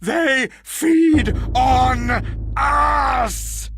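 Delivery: high, desperate screaming; frantic shout. Acted. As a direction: scream for them to listen. Madman screams for belief.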